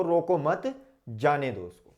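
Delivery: रोको मत joined and rising, जाने दो उसको falling; break after मत. Shown as a demonstration. Teacher explaining "let him go", breaking the phrase after मत. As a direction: lift through मत, then drop for जाने दो उसको. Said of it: "और आपने लगाया अल्प विराम".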